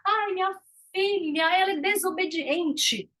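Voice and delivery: mock exasperated parent; complaining parent. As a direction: sigh it out like a tired parent. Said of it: "os pais falam assim".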